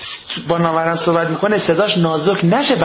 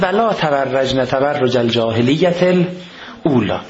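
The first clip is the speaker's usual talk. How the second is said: slow, measured recited Arabic verse; Quran recitation; 5 semitones lower, slower than usual. Acted. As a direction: recite the verse slowly and formally. (تلاوت آرام آیه)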